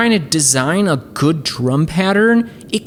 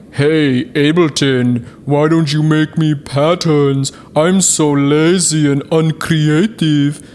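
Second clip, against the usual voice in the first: lazy whiny user; 3 dB louder than usual. Retold or imitated. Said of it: "about, like".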